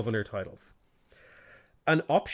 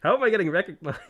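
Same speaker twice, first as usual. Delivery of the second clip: higher, half-laughing mock outrage; laughing disbelief; 6 semitones higher, 3 dB louder than usual. Streamer retelling being recognized in a Turkish lobby, laughing disbelief. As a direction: sputter in amused disbelief. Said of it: "It's like".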